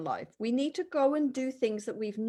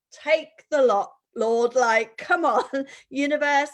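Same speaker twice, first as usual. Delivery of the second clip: exasperated surrender; 3 semitones higher, 7 dB louder than usual. Retold or imitated. Handing everything over to God, a loud half-laughing plea. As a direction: throw your hands up and hand it all over, half laughing. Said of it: "just surrender the whole bloody lot and go".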